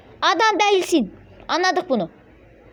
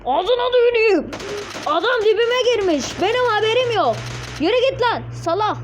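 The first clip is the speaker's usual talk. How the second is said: high-pitched, indignant gamer outburst; outraged cursing; 8 semitones higher than usual. Real, not acted. Player cursing the enemy who sneaked up on him, outraged. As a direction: curse the ambusher at a high pitch.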